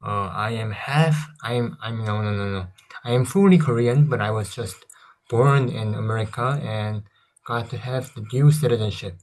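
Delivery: ordinary talk, nothing marked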